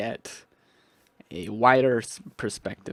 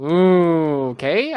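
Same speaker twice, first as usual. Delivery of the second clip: surprised ooh; 4 semitones higher, 10 dB louder, much slower than usual. Real, not acted.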